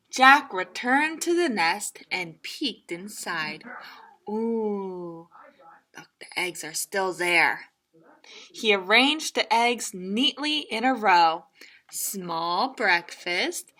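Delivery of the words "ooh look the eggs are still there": hushed whisper of discovery. A narrator's reading. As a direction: whisper so as not to wake anything. Peeking into the nest.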